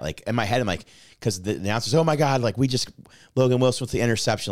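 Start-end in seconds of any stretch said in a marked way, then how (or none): 1.9-4.5 raised, higher mock play-by-play; loud announcer. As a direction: call the interception like an excited announcer. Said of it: "like, really loud".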